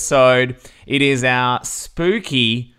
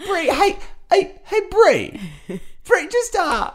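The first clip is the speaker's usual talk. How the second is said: much higher, squeaky playful calling; high silly voice. Pretend. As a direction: call her name in a squeaky cartoon voice. Host calls guest in silly voice.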